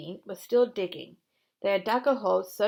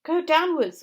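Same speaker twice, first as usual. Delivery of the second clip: high, loud command; ordered; 9 semitones higher, 9 dB louder than usual. Acted. Mister Fox commanding his family to dig down, loud order. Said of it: "ordered Mister Fox".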